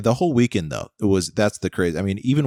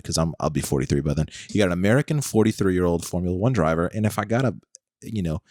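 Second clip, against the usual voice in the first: ordinary talk, nothing marked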